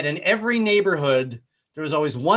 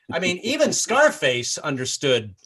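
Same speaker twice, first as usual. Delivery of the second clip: ordinary delivery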